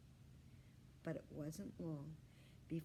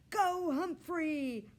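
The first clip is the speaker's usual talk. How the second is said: higher, louder, slow cheer; 11 semitones higher, 11 dB louder, much slower than usual. Acted. Aldo cheering Humphrey on the wheel, loud cheer. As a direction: cheer the hamster on.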